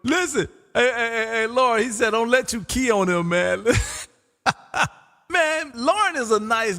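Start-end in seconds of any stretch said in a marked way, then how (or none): none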